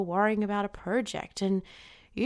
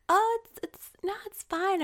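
much higher, self-effacing mock protest; bashful brush-off; 10 semitones higher than usual. Woman brushing off praise from other women, bashful and high. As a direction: wave off praise in a high little voice. Acting out modest deflection.

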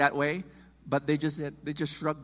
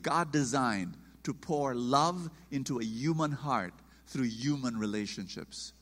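ordinary delivery

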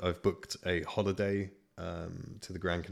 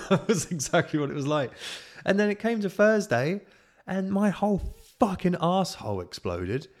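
much higher and louder, outraged telling; a loud, indignant punchline; loud exclaim; 11 semitones higher, 7 dB louder than usual. Real, not acted. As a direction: blurt it out in disgusted disbelief. Exclaiming about the illness.

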